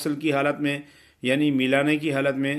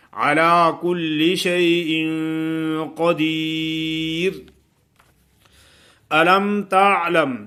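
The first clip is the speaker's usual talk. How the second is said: tajweed recitation; 2 semitones higher, 5 dB louder, much slower than usual. Acted. Teacher recites the verse for students, loud tajweed chant. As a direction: recite slowly with the nun held.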